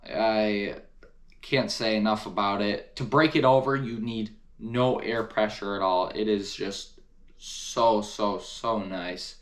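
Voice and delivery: ordinary talk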